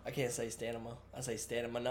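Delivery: "stamina" with the N and M swapped, like stanima; soft admission, tripping "stamina" into stanima; mispronounced stamina. Real, not acted. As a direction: say "stamina" as STAN-uh-muh. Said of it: "I put the M at the end instead of the N".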